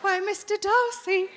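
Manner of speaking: mock Regency lady, slow and high; hammed-up bonnet-wearing lady; posh period lady. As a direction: simper it like a Jane Austen heroine.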